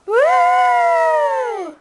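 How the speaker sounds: high excited cheer; cheering woo. Real, not acted. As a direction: whoop it up loud. Cheering Halloween start.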